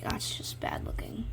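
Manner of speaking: a hushed, self-critical whisper; whispered aside. Real, not acted. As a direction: mutter it to yourself, unimpressed. Muttering about own drawing.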